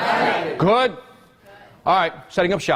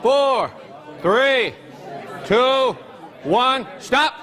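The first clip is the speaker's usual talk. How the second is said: countdown call; 8 semitones higher, much slower than usual. Real, not acted. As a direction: call time like a game show host. Teacher calling time on class.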